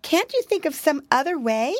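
put-on high, fretful little girl voice; a tiny frightened mouse wailing; timid mousy girl. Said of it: "she said".

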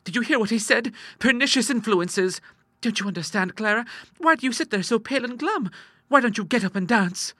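put-on high, excited girlish chatter; exultant sister Ida. Girl cheering up her glum sister, high exultant voice. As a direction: urge her on gleefully, high-pitched.

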